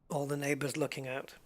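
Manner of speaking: ordinary delivery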